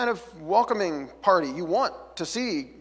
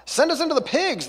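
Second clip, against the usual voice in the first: much higher, pleading cry; begging demons; 10 semitones higher, 4 dB louder than usual. Acted. Demons begging Jesus, high and pleading. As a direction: plead desperately to be sent into the pigs. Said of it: "they begged".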